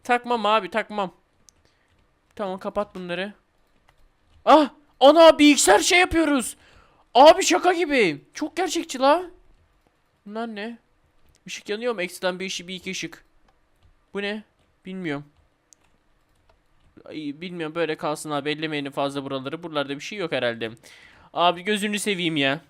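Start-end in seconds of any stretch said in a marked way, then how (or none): 4.4-9.2 high and loud amazement; thrilled gamer raving about the realism; excited amazement. Real, not acted. 10.2-20.7 softer, hushed exploring; creeped-out gamer hushing while poking around. Real, not acted.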